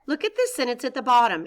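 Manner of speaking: ordinary delivery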